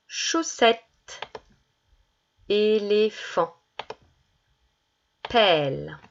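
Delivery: put-on each word said slowly and clearly for children; careful word list reading. Shown as a demonstration. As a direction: articulate every word for young pupils. (lecture de mots articulée pour enfants)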